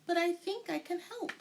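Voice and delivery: a sweet grandmotherly greeting; bright old woman. A narrator's reading. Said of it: "she said brightly".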